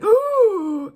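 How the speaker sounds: high bright-eyed ooh; delighted ooh. Pretend. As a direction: coo like spotting something pretty. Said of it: "they're like".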